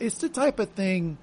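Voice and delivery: ordinary talk, nothing marked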